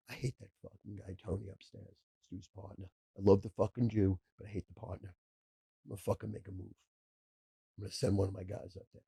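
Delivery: softly voiced tough guy from the mob, hinted at; hushed mobster. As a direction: murmur it like a quiet gangster bragging. Retold mobster threatening partner.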